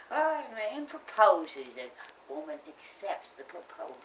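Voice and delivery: normal talking voice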